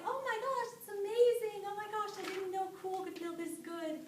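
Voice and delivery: a tiny animal amazed by the cool wind; delighted squirrel. A narrator's reading. Squirrel thrilled on the ride.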